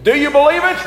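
high, loud host catchphrase; game show host. Pretend. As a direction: throw the catchphrase to the crowd. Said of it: "Can we practice that once?".

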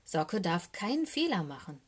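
usual speaking voice

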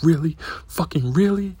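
hammed-up furious double-take; mock exasperated girlfriend. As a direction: imitate her outraged disbelief. Girlfriend sees another lime.

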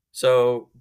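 normal talking voice

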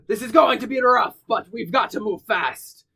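rally the crew over the noise; yelling to crew. Acted. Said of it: "you see Pitcher yelling out to everyone".

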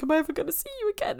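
a high, fast, lightly acted plea; Rose's pleading question. Rose on the beach asking the Doctor, mock-tearful high plea. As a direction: voice it up high like a desperate farewell.

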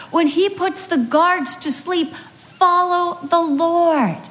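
rising preacher's cadence, building each line; impassioned preaching. Acted. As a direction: drive the repeated call home to the congregation. Preaching refrain at full force.

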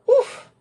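a sudden high oof of impact; playful oof as if landing hard; mock oof. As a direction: squeak out a comic oof. Jo imagining landing on Madge.

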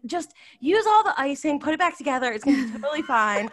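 high, loud, eager insisting; insistent. Retold or imitated. To husband over the broken gingerbread, high pushy cheer. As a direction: push on with bright determination.

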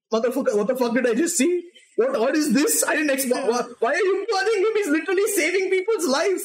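increasingly high-pitched disbelief; appalled onlooker, voice climbing; acted outrage. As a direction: build from shock to high, horrified protest. Acts out character horrified at burning.

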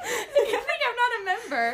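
laughing. Real, not acted. Mock outrage amid laughter.

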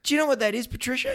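high, knowing tease rising on Patricia; retelling a cheeky quiz to a colleague; teasing question. Retold or imitated. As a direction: tease her with a loaded question. And I've gone.

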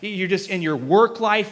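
ordinary talk

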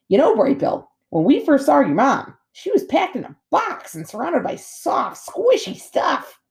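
high, swooping otter voice; storytelling otter, animated. A narrator's reading. Otter telling gosling how they met his mom, animated. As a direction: tease out the tale for a youngster.

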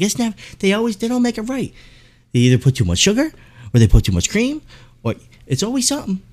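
high, put-on lady's voice, griping; complaining lady customer. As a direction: chime in with a high-pitched grumble. Imitating another lady chiming in complaining.

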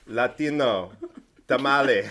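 a weak, jokey Spanish accent attempt; unconvincing Spanish accent. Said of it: "it's not very convincing".

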